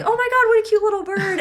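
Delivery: high, fast squeal over a cute bird; gushing delight. Acted. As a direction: rush it out, thrilled. Acted gushing partner, bid example.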